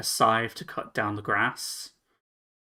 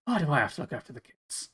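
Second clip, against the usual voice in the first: grumbling half-orc; 3 semitones higher, faster than usual. Acted. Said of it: "grunts a little bit and goes".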